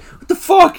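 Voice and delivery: high, loud mock disbelief; startled outburst. Co-host acting Jim's reaction to the text, startled outburst.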